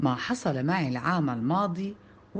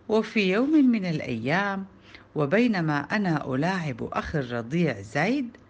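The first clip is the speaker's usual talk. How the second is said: plain reading voice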